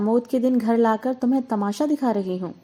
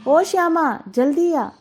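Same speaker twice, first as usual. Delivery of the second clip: loud call to the maid across the house; high ringing call to a servant; 5 semitones higher, 4 dB louder than usual. A narrator's reading. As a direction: shout her name so she hears from the kitchen. Calling the maid from afar.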